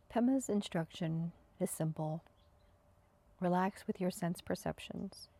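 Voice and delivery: calm, even meditation guidance with long pauses; spacious, unhurried meditation voice; guided meditation. Acted. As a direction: guide them into stillness, leaving space after each phrase. Leads breathing and senses meditation.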